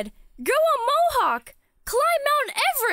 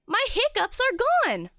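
ordinary delivery

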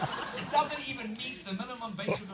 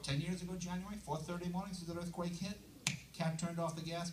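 ordinary talk, nothing marked

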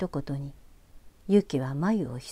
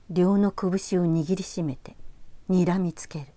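ordinary delivery, nothing marked

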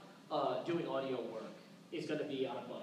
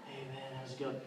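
laid-back mumble; 5 dB quieter than usual. Retold or imitated. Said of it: "talking like this all the time".

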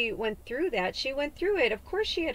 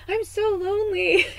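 high, loud whining lament; lonely whine; 11 semitones higher, 8 dB louder than usual. Retold or imitated. Alone last night, loudly whining to Spirit. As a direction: whine it like a hurt child. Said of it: "Last night I was like".

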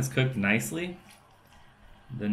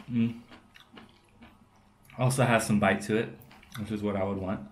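chewing while talking; speaking around food; mouth full; faster than usual. Real, not acted. Seen 3: Bites wood ear at -1.0, mouth closed chewing through the words.

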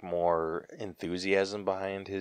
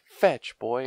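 retold call; 6 semitones higher, 6 dB louder than usual. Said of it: "talks to the, that kid about throwing the stick".

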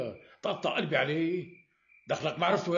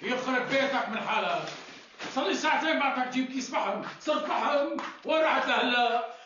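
arabic mother scolding; 7 semitones higher than usual. Acted. Scolds the late coal bearer.

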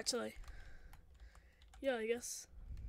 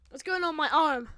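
raised, alarmed question; loud alarm; 8 dB louder than usual. Acted. Finding something wrong with arm, loudly alarmed. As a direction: cry it out in dismay.

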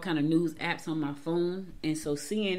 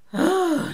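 long high sigh that falls away; audible sigh of dismay; heavy sigh; 11 semitones higher, 8 dB louder than usual. Real, not acted. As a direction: let out a weary sigh before the bad news. Sighing before the sad news.

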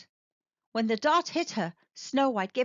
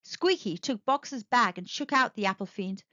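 ordinary delivery

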